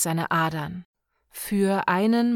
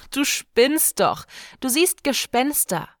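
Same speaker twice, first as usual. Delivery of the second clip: higher, louder laughing jeer; mocking; 6 semitones higher, 4 dB louder than usual. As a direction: laugh at the old fool. Björk mocking.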